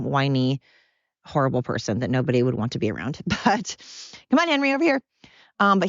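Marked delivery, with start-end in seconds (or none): 4.3-5.0 high, coaxing pet voice; calling a dog. Real, not acted. Owner calling blind Henry over, high coaxing call. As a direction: coax the dog your way, pitched up.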